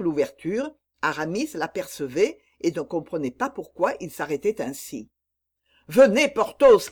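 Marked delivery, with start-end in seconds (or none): 5.9-6.9 urgent high shout. A narrator's reading. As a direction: call out to him desperately. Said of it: "criait Aramis".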